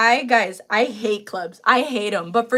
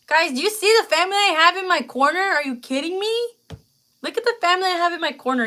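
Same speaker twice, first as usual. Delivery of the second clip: high, excited disbelief at the support; moved amazement; 8 semitones higher, 4 dB louder, faster than usual. Real, not acted. Showing viewers the family in her corner, amazed. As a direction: gush in a high, quick rush.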